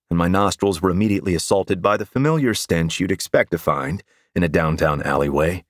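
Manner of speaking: usual speaking voice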